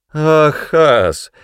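loud, high, teasing cry; amused exclamation. A narrator's reading. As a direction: exclaim as if laughing at him. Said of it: "рассмеялась Тананда".

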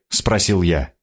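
ordinary delivery, nothing marked

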